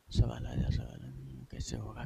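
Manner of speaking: soft, hushed pleading to oneself; imitating a whisper. Student stuck on a question whispers to himself, hushed. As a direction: whisper as if begging the answer to come.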